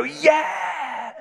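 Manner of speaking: high, drawn-out mock cheer; crowd cheering. Preacher acting out the crowd at Niagara Falls, cheering. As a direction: imitate a roaring crowd's yell.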